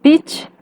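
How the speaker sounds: "Pitch" pitched high. Shown as a demonstration. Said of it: "Todas estas palabras tienen tono alto".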